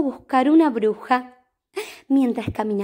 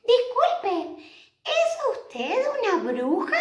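polite cat voice; 9 semitones higher, slower than usual. Retold or imitated. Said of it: "¿A quién le preguntó Horacio?".

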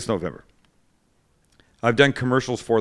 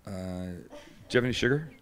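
mock timid ask; 4 semitones lower, 10 dB quieter than usual. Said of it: "Matt stands and he goes like this".

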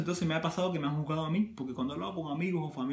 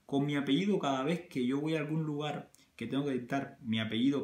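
usual speaking voice

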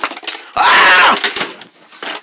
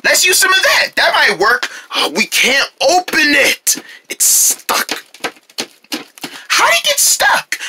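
frantic high voice; 9 semitones higher than usual. Acted.

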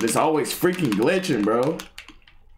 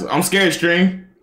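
high, louder plea with rising end; scared; 6 semitones higher, 5 dB louder, slower than usual. Real, not acted. Streamer telling the stream he's scared, high and pleading. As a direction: appeal to the viewers, voice pitched up.

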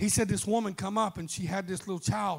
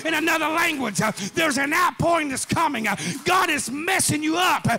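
raised, rhythmic sermon cry; full-force preaching; 5 semitones higher, 5 dB louder than usual. Acted. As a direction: preach it hard over the music. Preaching climax.

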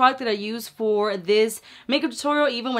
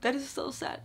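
mock sad; 4 semitones higher, 10 dB quieter than usual. Real, not acted. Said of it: "that's sad".

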